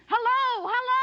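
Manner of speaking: loud high cry for help; shouting for help. Acted. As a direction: yell to be heard by the passer-by. Woman calls for help.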